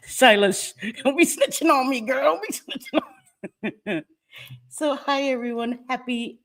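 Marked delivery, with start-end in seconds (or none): 1.0-4.1 high, laughing tease; giggling don't snitch on me. Real, not acted. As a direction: laugh it out, teasing a friend. Teasing viewer Silas, laughing.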